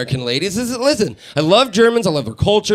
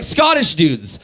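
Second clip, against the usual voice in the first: normal talking voice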